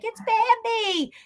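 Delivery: high, slow cutesy voice; mock cute. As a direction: say it like a sweet cartoon fawn. Friends mocking it as a deer.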